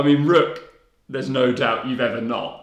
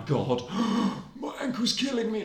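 a gasp, then higher hammed-up whimper; mock pain; 10 semitones higher, 5 dB quieter than usual. Boy overacts an ankle injury to get off the pitch, mock pain. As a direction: oversell a sore ankle. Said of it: "I was like".

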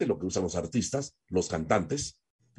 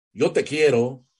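higher, louder, emphatic declaration of love; tone demonstration; 5 semitones higher, 5 dB louder than usual. Shown as a demonstration. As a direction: declare it out brightly to a partner. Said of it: "la forma como decimos las cosas". (declaración de amor enfática, más aguda y fuerte)

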